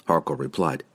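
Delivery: ordinary delivery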